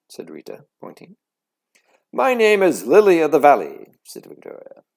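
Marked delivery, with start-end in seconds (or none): 2.1-4.0 very loud, higher showy announcement; a girl boasting to the class; proud girl voice. Acted. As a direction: show off the new name.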